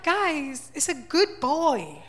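high, slow pleading to the trolls; mock pleading. Defending her dog photo in a slow, pleading voice. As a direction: gently beg them to see reason.

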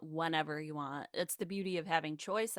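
ordinary talk, nothing marked

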